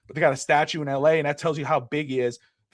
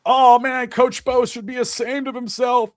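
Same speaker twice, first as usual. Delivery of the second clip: mocking critic; 7 semitones higher, 5 dB louder than usual. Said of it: "You know".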